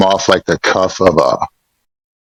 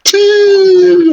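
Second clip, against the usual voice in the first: much higher squeaked echo; mocking squeak; 4 dB louder than usual. Player mocks the servant's tea, squeaked high.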